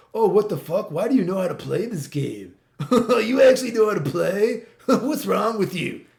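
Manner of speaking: high, loud, laughing imitation of baffled rivals; loud, cackling mock astonishment; mock incredulous outrage. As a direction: act out their stunned disbelief, laughing through it. Mocking rivals' incredulous reaction, laughing.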